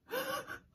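high sharp mother's gasp; startled gasp. Acted. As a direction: gasp in alarm at the baby. Mama sees upset baby.

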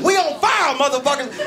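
high yelled alarm. Retold or imitated. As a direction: yell it high at the room. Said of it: "was like".

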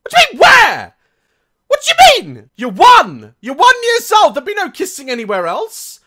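high, loud disbelieving shout; shocked outburst. Real, not acted. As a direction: blurt it out in shock.

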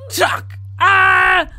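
very high, loud shocked shriek; stabbing sound and scream. Real, not acted. Gamer watching the doll stab the girl shrieks, high and loud. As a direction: shriek as the knife goes in.

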